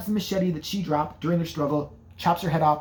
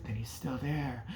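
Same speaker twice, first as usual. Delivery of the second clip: eerie hush; 5 semitones lower, 10 dB quieter, slower than usual. Retold or imitated. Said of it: "she's like".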